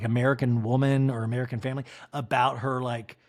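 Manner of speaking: ordinary talk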